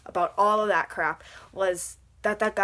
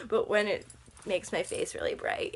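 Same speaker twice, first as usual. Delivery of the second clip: ordinary talk, nothing marked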